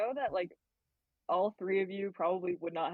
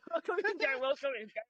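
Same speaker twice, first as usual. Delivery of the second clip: much higher mock welcome; 8 semitones higher than usual. Player parroting the coven welcome, much higher. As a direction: chirp the greeting.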